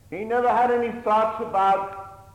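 a preacher raising his voice for the repeat; loud preaching emphasis. Acted. Preacher repeats the line louder.

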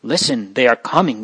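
ordinary delivery, nothing marked

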